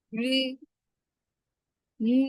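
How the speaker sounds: রি said as r blended into i; রি with r and i merged, not plain ri; রি, a mixed r-i sound; pronunciation demo. Shown as a demonstration. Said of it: "এটার উচ্চারণ কি অনেকটা র আর একটা ই মিক্স করে".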